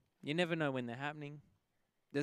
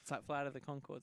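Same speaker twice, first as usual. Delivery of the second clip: ordinary talk, nothing marked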